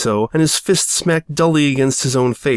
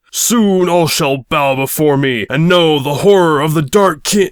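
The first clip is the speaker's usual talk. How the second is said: louder and higher, booming proclamation; booming villain; 4 semitones higher, 4 dB louder than usual. Acted. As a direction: boom it out to the world. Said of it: "his voice boomed".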